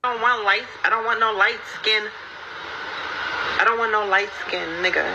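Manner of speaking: ordinary delivery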